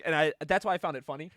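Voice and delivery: normal talking voice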